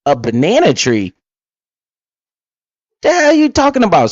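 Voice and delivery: mock the idea in disbelief; incredulous. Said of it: "They say".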